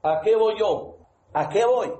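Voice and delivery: usual speaking voice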